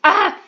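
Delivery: short loud high-pitched yelp; a stupid noise, yelped out loud; sudden high yelp. Real, not acted. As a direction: let out a silly squeal. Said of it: "I'm done making stupid noises".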